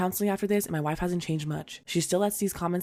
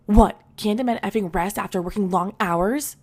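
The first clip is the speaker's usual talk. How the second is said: loud, slow mock-macho complaint; hammed-up macho father; macho dad voice; 3 semitones higher, 7 dB louder, slower than usual. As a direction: puff up and play the grumpy working father. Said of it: "in the most macho voice I could muster".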